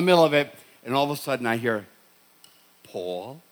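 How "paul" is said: hushed, low "Paul?" rising softly in the dark; a mother quietly calling her son on the stairs at night; mother's gentle call. Retold or imitated. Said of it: "when I heard my mother say my name".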